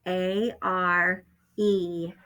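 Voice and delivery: letters drawn out one by one; spelled out slowly. Shown as a demonstration. Teacher spelling a trick word slowly for first graders. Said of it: "and then E".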